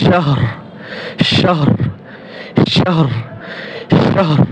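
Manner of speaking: শাহর short and clipped, the হ breathed and the র barely sounded; pronounce shahr. Shown as a demonstration. Teacher repeating the word for students to copy, crisp clear শাহর. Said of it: "উচ্চারণটা কিরকম?".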